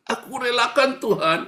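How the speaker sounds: badly faked weeping prayer; fake crying. Preacher mimics husband giving up his wife to God, pretend crying. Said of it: "Karena pura-pura nangis".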